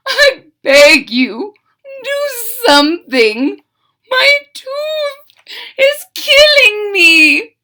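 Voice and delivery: high, slow, wailing plea; pleading fox crying. Acted. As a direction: wail like a fox in agony. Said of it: "falling to his knees".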